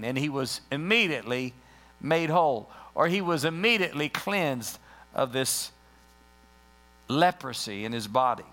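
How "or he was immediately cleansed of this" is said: usual speaking voice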